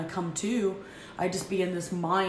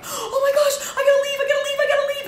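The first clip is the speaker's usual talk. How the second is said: much higher and louder, breathless half-laughing panic; frantic rush, realizing you're late; panicked; 8 dB louder, faster than usual. Retold or imitated. Mom late to pick up her kids, panicked and frantic. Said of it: "go into a panic".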